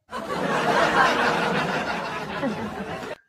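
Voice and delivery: loud mocking cackle; loud evil cackle. Real, not acted. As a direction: cackle at the haters. Mocking haters, evil laugh. (tawa jahat keras)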